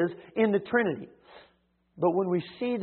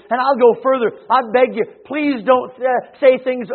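ordinary delivery

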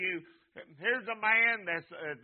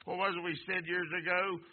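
ordinary talk, nothing marked

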